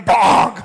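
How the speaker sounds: loud, sudden smack noise; imitated slap. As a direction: pop it out like a smack landing. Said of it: "mbok parani kaplok meneng".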